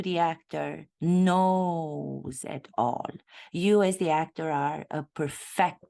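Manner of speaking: usual speaking voice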